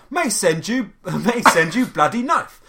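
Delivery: mimicking a friend's loud Cockney, amused; mock Cockney with "the" dropped; imitated Cockney. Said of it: "You were like".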